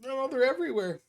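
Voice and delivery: high, hopeless reply; scared boy. Acted. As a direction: despair of a cornered kid. GM voices the frightened boy.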